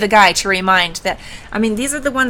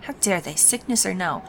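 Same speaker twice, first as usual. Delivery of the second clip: muttering; 7 dB quieter than usual. Acted. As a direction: mutter it under your breath. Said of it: "Sevelin mutters".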